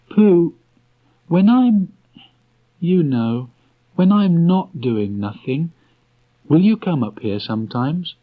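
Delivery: put-on high, halting boyish voice; hesitant boy. A narrator's reading. Christopher Robin trying to tell Pooh, high halting voice. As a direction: stumble over the words like a shy child.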